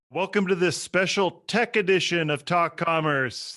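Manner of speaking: loud, high-pitched podcast welcome; show-opener announcer. Pretend. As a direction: belt out the show intro like an announcer. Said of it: "This is where I do the effects".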